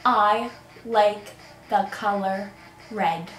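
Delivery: slow, word by word; slow lip-reading prompt. Shown as a demonstration. Player giving a friend a phrase to lip-read, slow and deliberate. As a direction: spell it out slowly for a lip-reader. Mouthing slowly for lip-reader.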